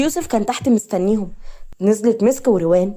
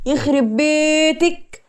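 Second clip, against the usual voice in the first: drawn-out, higher-pitched mock scolding; exasperated exclamation; 6 semitones higher, much slower than usual. A narrator's reading. Friend reacting to her friend's scheme, exasperated. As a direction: stretch the curse out in exasperation.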